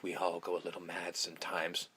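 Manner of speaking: low, hushed menace; quietly evil. Retold or imitated. Host as killer turning evil, low and sinister. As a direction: drop low and very soft, sinister. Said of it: "Billy just literally turns in the evil eye".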